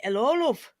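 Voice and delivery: 'Ello, love in a northern English accent, with love as "lov"; a northern bloke saying "'Ello, love". Shown as a demonstration. British guest contrasting the northern take with the London one. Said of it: "that would be in northern accent".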